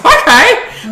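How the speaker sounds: high rising okay; surprised okay. Retold or imitated. Retold reaction to finishing bottle.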